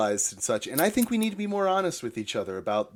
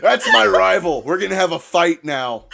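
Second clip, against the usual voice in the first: loud, amused play-acted announcement; mock challenge; 3 semitones higher, 10 dB louder than usual. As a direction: declare a showdown at full volume. Acts out announcing the rival, laughing.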